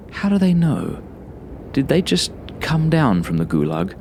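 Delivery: ordinary delivery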